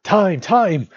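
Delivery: high, loud panicked squeal; loud strangled-with-panic yelp; sudden squeal. A narrator's reading. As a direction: squeal the word out in desperation. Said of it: "all that came out was a sudden squeal".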